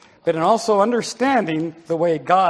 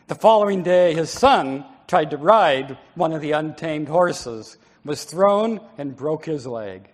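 ordinary talk, nothing marked

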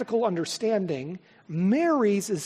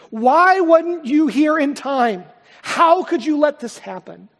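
anguished shout; 6 semitones higher, 9 dB louder than usual. Acted. Grieving believer shouting at Jesus, anguished shout. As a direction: shout the why, louder and higher. Voicing the grieving listener's question.

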